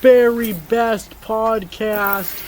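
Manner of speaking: put-on high young woman voice; young lady voice. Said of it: "That was my young lady voice".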